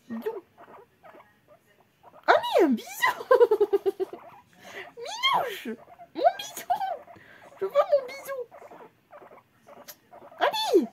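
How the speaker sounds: hammed-up begging voice; whiny pet baby talk. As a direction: plead like a spoilt child.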